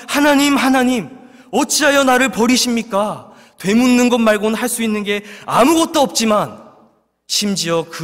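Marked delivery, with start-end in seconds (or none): 0.1-3.3 desperate cry. Acted. Psalmist's desperate cry quoted.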